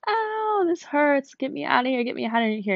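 high wail of pain easing into pleading; hammed-up pained complaint; whining weakened ego. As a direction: whine to be let out, starting high and sliding down. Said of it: "my ego was starting to weaken".

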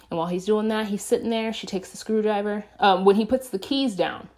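usual speaking voice

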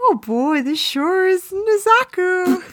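high, slow, dopey cartoon-bear voice; badly faked soldier voice that lands as a cuddly cartoon bear; failed character voice. Friend's turn at the meme line, comically wrong high voice. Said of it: "is he Winnie the Pooh in this?".

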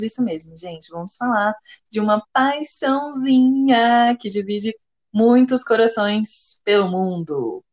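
usual speaking voice

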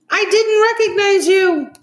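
very high, loud squeal; high-pitched, overwhelmed mom; amazed exclamation. A narrator's reading. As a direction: cry it out in amazed delight. Mom, overwhelmed, exclaims to her daughter.

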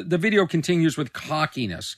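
ordinary talk, nothing marked

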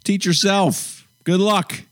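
high, slow easygoing teacher; breezy teacher voice. Pretend. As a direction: wave the kids in casually. Imitating Montessori teacher.

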